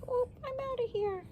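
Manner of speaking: high, quick little frog voice. Retold or imitated. Little frog bolting away, high and quick. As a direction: squeak it out fast like a tiny frog. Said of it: "He's like".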